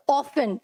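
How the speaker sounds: often said as OFF-un, no T sound; often pronounced without its T; often with silent T. Shown as a demonstration. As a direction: say often like "awfun", the T left silent. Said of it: "T silent रहता है इसमें".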